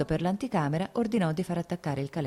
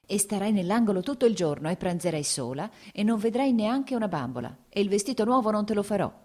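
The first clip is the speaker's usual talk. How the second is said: angry scolding; 6 semitones higher than usual. Acted. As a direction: scold the little girl sharply, mother at her wits' end. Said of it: "diceva arrabbiata".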